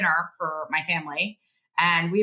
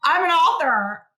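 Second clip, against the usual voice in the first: high, loud, slow announcement; hammed-up giddy proclamation, pitched way up; giddy and cheesy; 11 semitones higher, 8 dB louder, slower than usual. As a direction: announce it slowly with cheesy giddiness. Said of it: "so giddy and, like, so cheesy about it".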